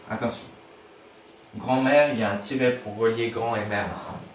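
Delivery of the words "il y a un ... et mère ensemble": ordinary talk, nothing marked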